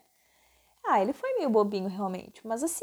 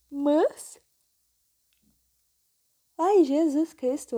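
words stretched by a yawn; 3 dB louder, slower than usual. Real, not acted. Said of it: "estou causando bocejos".

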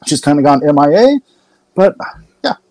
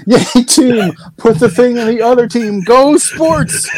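mock cheering; 10 semitones higher, slower than usual. Mocking sports fans.

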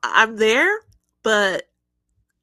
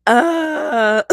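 cracking voice mid-word; 6 semitones higher, 9 dB louder than usual. Real, not acted. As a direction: voice cracks on a hesitant uh. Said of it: "my voice cracked".

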